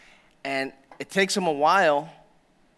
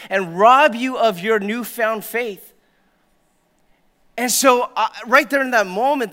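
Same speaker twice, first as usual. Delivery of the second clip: full-force preaching; 6 semitones higher, 6 dB louder than usual. Acted.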